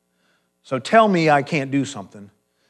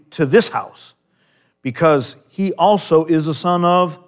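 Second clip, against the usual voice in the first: raised, louder pulpit reading of the Bible; preaching scripture reading; 3 semitones higher, 5 dB louder than usual. Acted. Preacher reading the Zacchaeus story to church, raised and proclaiming. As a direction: proclaim the verses to the congregation.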